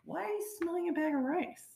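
high-pitched incredulous question; mock bafflement. Co-host challenging the bag of rice remark, high and incredulous.

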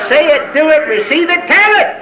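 fervent, rising pulpit call; rousing preaching. Real, not acted. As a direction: pitch climbing with each step. Preacher climbs through the four steps.

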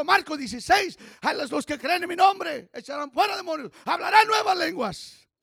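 loud, building pulpit proclamation; preaching at full force. Acted. Preacher listing verses to share, loud and building. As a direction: drive each scripture home.

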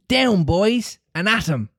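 rally the troops, ringing out; ringing rallying cry. A narrator's reading. Peter urging the boys into the fight, high ringing rally. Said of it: "Peter's voice rang out".

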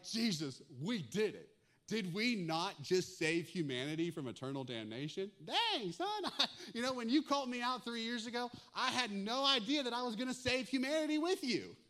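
loud bragging buddy, high on Dang son; boastful disciple. Pretend. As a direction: brag to Jesus like a teammate.

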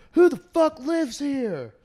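loud, high, drawn-out angry shout; angry man yelling. Retold or imitated. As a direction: act out a furious man confronting the house.

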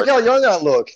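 high, loud teasing imitation; mock boasting. Cohost mimics friend showing off old pictures, mock boasting. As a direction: crow it in an excited mock voice.

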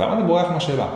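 high-pitched mock challenge; mock protest. Viewer reacting to an interviewee dodging, high mock protest. Acts his reaction to the dodged question.

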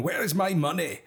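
angry Scottish creditor voice; a poor put-on Scottish accent; bad Scottish accent. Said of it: "I can't do a Scottish accent".

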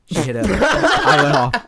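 laughing, loud, mic distorting; slow laughing yell that clips the mic; clipping distorted mic. Real, not acted. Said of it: "Your mic blew out".

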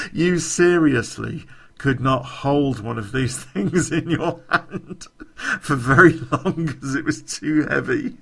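words broken by real laughter; can't stop giggling at the memory; laughing through it. Real, not acted. Laughing while telling mates about the brick phone.

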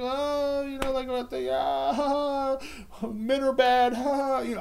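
high falsetto gossip voice with giggles; mock talk-show host. Mocking The View hosts, high and giggly. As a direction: squeal like a daytime panel host, laughing. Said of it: "I can talk about The View".

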